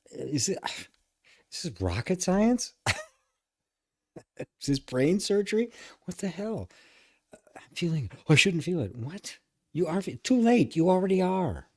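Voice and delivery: high-pitched incredulous rant; exasperated mock disbelief. Real, not acted. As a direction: scoff at the idea, pitch way up. Mocking being told not to feel.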